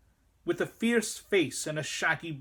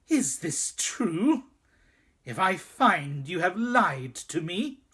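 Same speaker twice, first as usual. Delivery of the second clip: slow, louder menacing demand; threatening; 4 semitones higher, 4 dB louder than usual. A narrator's reading. Queen threatening the boy over his news, menacing. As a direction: warn him with slow menace.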